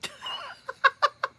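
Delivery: squealing burst of laughter; delighted high-pitched laugh. Real, not acted. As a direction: shriek with laughter. Reacting to chat.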